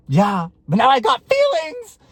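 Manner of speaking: high, slow mock wail; hammed-up high-pitched protest; exasperated outburst. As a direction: whine it out loudly in protest. Acting out her protest to ex.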